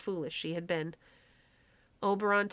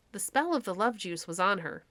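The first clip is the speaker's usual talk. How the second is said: usual speaking voice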